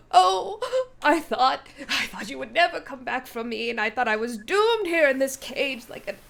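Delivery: high, loud, laughing through tears; weeping happy tears. Acted. Freed knight weeps happy tears at his rescuers. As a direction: blubber with joy. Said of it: "and he's like".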